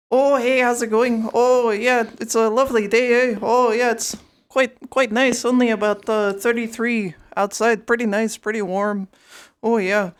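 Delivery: put-on loud, high, sing-song Minnesota accent; chirpy small talk in a Minnesota accent. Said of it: "that's Minnesota".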